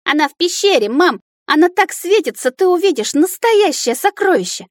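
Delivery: put-on high, eager little-kid voice; high-pitched breathless childish enthusiasm; excited child dragon. A narrator's reading. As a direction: gush to mama about a wonderful find. Child excitedly persuades his mother.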